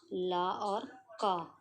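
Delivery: ला and का drawn out very slowly; teacher splitting lack; slow sounding out. Shown as a demonstration. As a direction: stretch each sound of lack. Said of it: "ला के बाद क्या आ रहा है".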